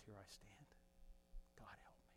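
very low, hushed confession; barely above a whisper. Acted. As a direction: say it almost inaudibly, humbly. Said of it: "He said it quietly, barely above a whisper".

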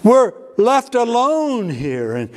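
mock the church's whining; whining Christians. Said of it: "Christians to say".

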